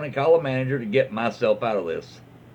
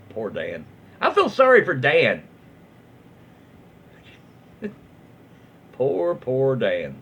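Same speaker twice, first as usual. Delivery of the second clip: mock pity. Real, not acted. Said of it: "pitying Dan".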